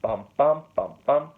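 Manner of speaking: beats sung out as "Bum, bum"; rhythmic demo of the feet; rhythm syllables. Shown as a demonstration. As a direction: tap out the meter with "Bum" on each beat. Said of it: "six of those feet".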